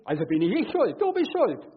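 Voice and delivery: mock huffy blame-shifting; defensive excuse. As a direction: rattle off the excuse, passing the buck. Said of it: "Nach dem Motto".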